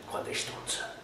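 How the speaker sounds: hissed under the breath; whispered insult. Acted. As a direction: whisper it bitterly. Lui insulta sottovoce. (insulto sussurrato)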